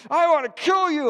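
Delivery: loud, high mock-furious yell at the sheep; furious shout. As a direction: explode at the sheep. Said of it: "he was so mad".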